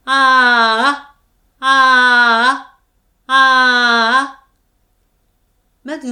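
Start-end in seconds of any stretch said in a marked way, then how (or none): 0.1-4.5 a long, louder ah at a comfortable pitch; sustained ah. Shown as a demonstration. Voice teacher demonstrates a belly-supported ah, loud and steady. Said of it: "こんな感じで声を出してみてください".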